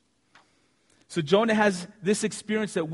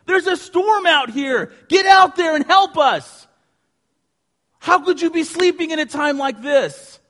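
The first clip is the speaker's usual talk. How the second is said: full-voiced angry yell, pitched way up; captain yelling; 10 semitones higher, 9 dB louder than usual. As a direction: holler over a storm at a sleeper. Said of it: "he yells at him".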